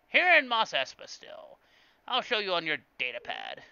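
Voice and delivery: loud, high put-on merchant voice; hammed-up alien shopkeeper giving directions; Watto. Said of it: "I can't do Watto's voice".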